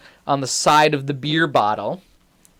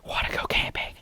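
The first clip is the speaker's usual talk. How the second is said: soft, voiceless whisper into an ear; 10 dB quieter than usual. Retold or imitated. Dude brushing past Luke at the door, conspiratorial whisper. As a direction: whisper it like a secret proposition. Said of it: "he whispers in my ear".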